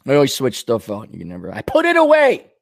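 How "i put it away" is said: sudden high mock shout; exasperated outburst. Man whose things get moved, exasperated high outburst. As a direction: jump up in pitch, exasperated.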